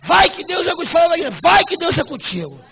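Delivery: loud, high, urgent preacher's call; full-force preaching. Acted. Pastor sending the church out, loud urgent preaching. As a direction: send them off with a loud push.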